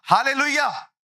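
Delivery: a high, excited hallelujah; high hallelujah cry. Retold or imitated. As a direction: burst out with praise. Shouting hallelujah in quiet church.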